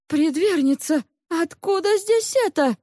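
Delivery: high, startled question; astonished. A narrator's reading. Lisian sees dead cats and questions the maid, astonished. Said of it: "прикрыл руками рот от удивления".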